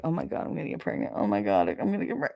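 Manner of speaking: fast, hushed mock panic. Woman in her twenties fearing pregnancy, hushed panic. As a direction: mutter it quickly, as if fretting to yourself.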